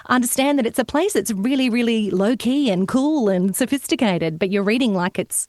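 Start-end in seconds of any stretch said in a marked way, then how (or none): none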